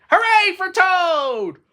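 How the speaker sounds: high, loud, drawn-out hooray; shouted cheer. Acted. Frog cheers Toad from the audience, long high shout. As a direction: cheer at full voice, stretching the words. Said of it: "he shouted".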